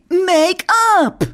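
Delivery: high, loud English cry; bright magical girl. Retold or imitated. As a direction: call it out like an anime heroine. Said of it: "auf Japanisch und auf Englisch sagen sie".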